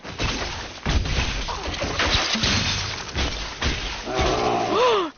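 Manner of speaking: long breathy gasp of shock; sharp gasp. Real, not acted. Gamer seeing a bear appear, startled gasp. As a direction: suck in air in alarm.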